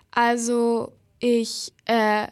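halting, with gaps between the words; hesitant stammer. A narrator's reading. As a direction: falter word by word, searching for an answer. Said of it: "stotterte Morrigan". (zögerlich stotternd)

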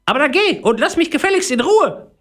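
tiny, very high, cross voice; squeaky little grumbler shooing someone off; scolding little voice. A narrator's reading. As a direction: scold like a very small person. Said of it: "schimpfte das Stimmchen".

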